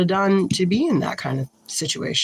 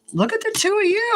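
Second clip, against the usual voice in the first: surprised exclaim; faster than usual. Real, not acted. Surprise at the cards.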